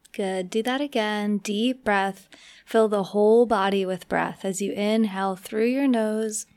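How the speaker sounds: unhurried meditation guide with long pauses; measured, spacious delivery for relaxation; guided meditation. Acted. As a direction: ease the listener slowly into relaxing.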